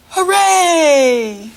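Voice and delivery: loud, high, drawn-out hooray; big cheer on the count of three. Shown as a demonstration. Teacher leading kids in a loud high hooray. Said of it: "Can you say hooray?".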